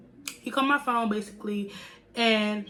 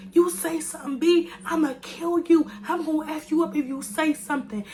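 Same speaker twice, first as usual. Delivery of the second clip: screaming threat; 6 semitones higher than usual. Retold or imitated. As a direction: yell it like she's threatening to kill you. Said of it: "she's in the background screaming".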